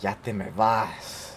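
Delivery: low, slow, mildly saddened imitation; sad manager. Manager seeing him off in Spanish, imitated mild sadness. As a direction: imitate her resigned sadness, understated. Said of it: "She was sad".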